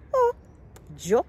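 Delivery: usual speaking voice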